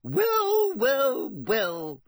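high, fluting portrait lady; fat lady voice. Acted. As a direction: sing it out like a grand old painting. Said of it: "said the fat lady".

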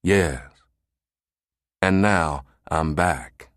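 slow, measured menace; menacing authority. A narrator's reading. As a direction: answer coldly, drawing out each phrase. Glaring boss answers flustered aide.

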